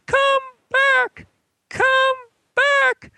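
very high-pitched imitated far-off holler; distant yell. Man on the beach yelling to a swimmer, high-pitched holler. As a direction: call out each word high and drawn apart, like someone across a beach. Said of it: "yelling something like".

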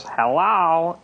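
put-on high, sweet little girl greeting. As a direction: chirp it like a small girl. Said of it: "this little girl voice that goes".

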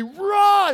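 loud, high "rah" roar; roar of effort. Acted. Preacher as Samson breaking the ropes, roaring. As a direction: burst out with a big yell.

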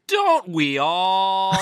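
slow, high evasive politician; spinning politician. Acted. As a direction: breezily dodge the question. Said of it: "crape your way out of it".

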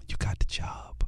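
soft whispered news; hushed announcement. Retold or imitated. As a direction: whisper it like a secret. Yolanda announces the job.

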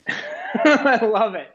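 laughing while speaking; big delighted laugh. Real, not acted. Laughing at conspiracy theory.

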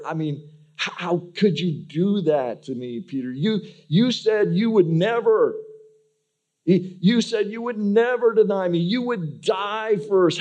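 imagined Jesus scolding Peter, rising to outrage; disdainful rebuke. Retold or imitated. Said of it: "with just disdain".

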